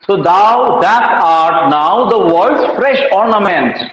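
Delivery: very slow, each word drawn out; slow verse recital. Shown as a demonstration. Teacher rereading the corrected sonnet line, very slowly.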